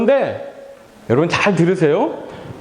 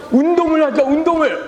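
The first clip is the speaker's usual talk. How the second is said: high, punchy rallying cry; rally yourself to start exercising; acted resolve; 8 semitones higher than usual. Pastor re-enacting his decision to exercise, high energetic resolve.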